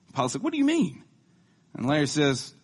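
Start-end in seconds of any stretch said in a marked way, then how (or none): 0.4-1.0 high, baffled question; sudden confused pilot; confused squeak. Acted.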